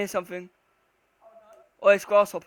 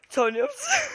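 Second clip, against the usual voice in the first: frightened whimper. Real, not acted. Scared, calling friend.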